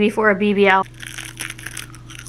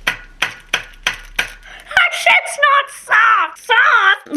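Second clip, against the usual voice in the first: outraged shout; 11 semitones higher, much slower than usual. Real, not acted. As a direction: bellow at the rock-hard candy. Candy stick too hard to bite.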